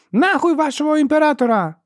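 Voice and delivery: very high, loud angry shout; defiant yell. A narrator's reading. Ferro curses the imperial riders, yelling defiantly. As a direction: scream the curse back at them.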